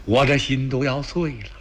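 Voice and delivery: ordinary delivery, nothing marked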